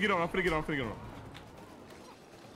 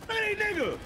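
exasperated high outburst; 12 semitones higher than usual. Real, not acted. Enemies swarm.

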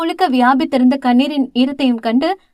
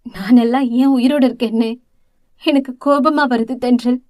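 ordinary delivery, nothing marked

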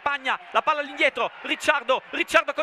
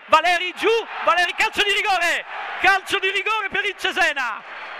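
excited penalty call; 5 semitones higher than usual. Real, not acted. Penalty awarded to home side.

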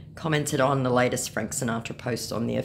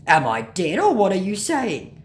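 loud, higher mock bafflement; scammer; 6 semitones higher, 6 dB louder than usual. Scammer asked to play someone living, mock baffled. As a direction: play an indignant, confused scammer. Acting out scammer's confused question.

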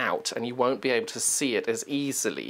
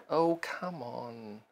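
coaxing the machine; 8 dB quieter than usual. Real, not acted. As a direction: quietly beg it to behave. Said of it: "talk to their sewing machine".

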